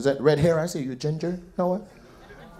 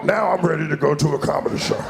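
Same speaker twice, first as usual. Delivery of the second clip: usual speaking voice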